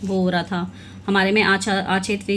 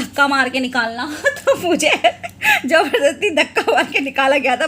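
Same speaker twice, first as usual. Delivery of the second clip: laughing through the words, a bit higher and brighter; 6 semitones higher, 4 dB louder than usual. Real, not acted. Recalling being pushed out at the farewell, laughing. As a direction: giggle as you tell it.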